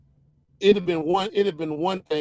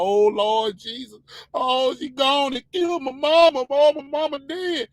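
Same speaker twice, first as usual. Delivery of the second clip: hammed-up crying and hollering, rising higher; mock wailing; 6 semitones higher, 3 dB louder than usual. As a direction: mimic someone wailing over a loss. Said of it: "we crying and whining and hooping and hollering".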